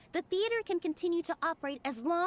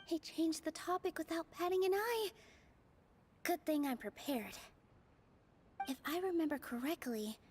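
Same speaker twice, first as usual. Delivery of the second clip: inner thoughts; 5 dB quieter than usual. Acted. Inner thoughts during a debate.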